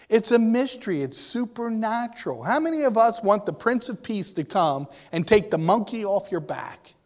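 ordinary delivery

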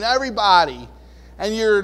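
ordinary delivery

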